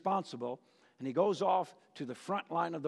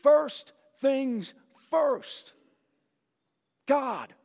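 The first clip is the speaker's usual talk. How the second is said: David resolute; 6 semitones higher, 3 dB louder, slower than usual. Acted. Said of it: "and says".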